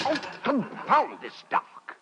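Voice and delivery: slow, high, tense muttered curse at the dark; anxious curse. Acted. Denis lost in the dark lane, cursing anxiously. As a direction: fumble in the dark, nerves fraying.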